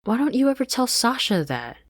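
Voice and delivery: high, loud whiny complaint; complaining Anne. Acted. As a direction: protest to Marcy.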